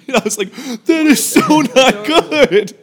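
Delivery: high, slow, laughing it out; words squeezed through real laughter. Real, not acted. Retelling the bumped kid to friends, laughing hard.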